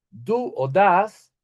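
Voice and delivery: ordinary delivery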